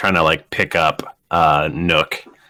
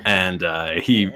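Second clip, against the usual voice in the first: normal talking voice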